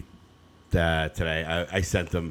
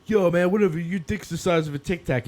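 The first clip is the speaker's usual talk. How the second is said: mock trash talk; 9 semitones higher than usual. Retelling a TikTok, voicing a player taunting the kid. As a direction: taunt like a cocky online player. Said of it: "guy yells at me, he's like".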